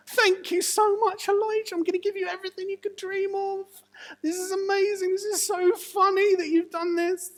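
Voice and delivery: high, loud, gleeful king voice; cackling with glee over his captives; giddy excited king. Acted. King of Israel gloats to Elijah, high and giddy. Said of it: "you can see he's so excited".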